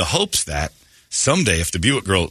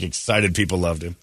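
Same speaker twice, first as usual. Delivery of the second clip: ordinary talk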